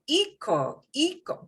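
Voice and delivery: "eco" said twice with the E stressed; stress on first syllable. Shown as a demonstration. Teacher showing what the rule would give, "eco" stressed on the E. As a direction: put the weight on the E of each "eco". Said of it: "shows where we stress because it should be".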